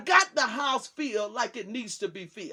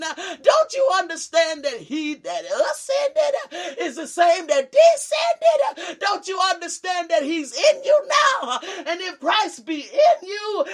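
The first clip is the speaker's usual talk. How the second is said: higher, louder, fervent cries; shouted climax; 5 semitones higher, 7 dB louder than usual. Real, not acted. Preacher rousing the church about Christ within, shouting. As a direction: lift the congregation, shout it out.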